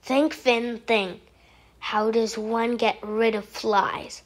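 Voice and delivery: low, soft self-talk; quiet low thinking aloud; muttering to self. Acted. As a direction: puzzle it out under your breath. Muttering to himself.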